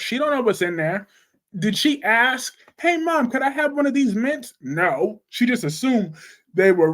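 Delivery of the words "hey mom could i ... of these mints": high, sweet mock request to mom; asking mom sweetly. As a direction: play the polite daughter asking, pitched up. Acts Luna asking mom permission.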